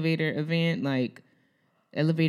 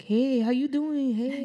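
catcalling; 9 semitones higher than usual. Retold or imitated. Guys catcalling her in the mall, mocking imitation. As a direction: imitate guys hollering at a girl. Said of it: "catcalling me".

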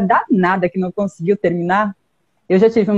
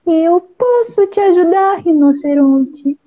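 high little bird voice; cartoon bird voice; 7 semitones higher than usual. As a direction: sing it out like a tiny bird. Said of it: "disse o passarinho".

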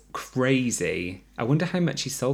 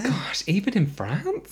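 whispered surprise; 10 semitones higher than usual. Real, not acted.

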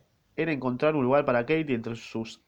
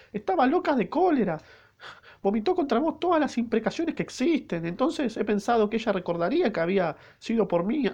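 put-on higher falsetto young maid, breathless and worked up; 10 semitones higher than usual. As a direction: tell the escape in a high, anxious girl's voice. Reader voices the maid.

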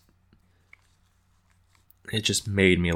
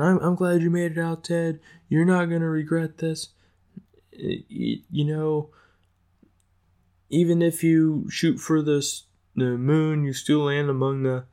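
higher-pitched put-on character voice; acted reassurance, pitched up; Gary encouraging Ted; 8 semitones higher than usual. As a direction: play a pep talk in a raised voice. Acts out Gary consoling Ted.

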